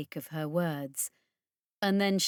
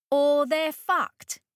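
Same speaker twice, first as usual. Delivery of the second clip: loud, higher, slowed emphasis; blunt outburst; 8 semitones higher, 6 dB louder, slower than usual. Acted. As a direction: spit out the grim verdict. Blunt warning about the danger.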